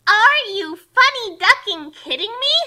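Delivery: loud, slow, high disbelief; incredulous. Acted. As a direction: drag it out in outrage. Kasumi's incredulous look voiced.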